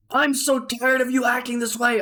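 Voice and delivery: high-pitched mock yelling; angry again. Boss resuming his row with the chef, mock angry yell. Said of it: "He went from angry, red face".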